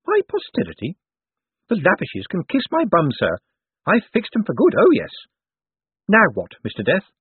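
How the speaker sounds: put-on high, defiant old lady; Topsy Lavish. A narrator's reading. Dead woman scoffs at her family to Death, high and defiant. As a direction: pitch it well up, indignant and pleased with yourself.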